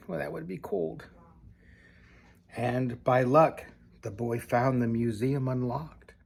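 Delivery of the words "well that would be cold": quiet aside. Real, not acted. Soft aside remark.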